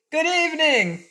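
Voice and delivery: high-pitched mock yell. A narrator's reading. Cake yelling close to the silent ferryman's ear, high narrated yell. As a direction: call it out very high, without full volume. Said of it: "she yelled as loudly as she could".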